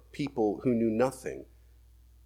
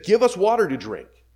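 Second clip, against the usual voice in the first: demanding crowd; 6 semitones higher, 7 dB louder than usual. Acted. People demanding water from Moses.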